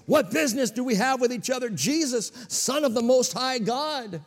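high, loud, fast demon cry. Retold or imitated. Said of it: "again, with a loud voice".

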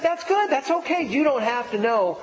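re-enacted high-pitched encouragement; upbeat reassurance. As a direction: act out eagerly reassuring a daughter. Acted father reassuring his daughter.

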